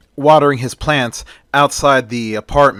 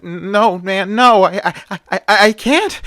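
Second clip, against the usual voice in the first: pleading fear; 7 semitones higher than usual. Acted. Johnny refuses, terrified.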